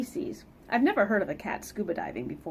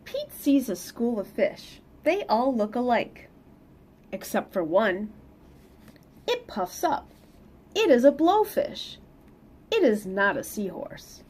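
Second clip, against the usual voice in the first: lively read-aloud storytelling; picture book reading voice; story narration; 4 semitones higher, 6 dB louder than usual. Acted. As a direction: read it to the kids with bounce.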